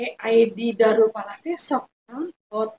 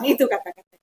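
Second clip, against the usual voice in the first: ordinary talk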